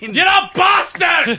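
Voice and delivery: high, drawn-out hammed-up yell; mock insult shout. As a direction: shout it slowly over the laughter. Acted outburst interrupts the joke.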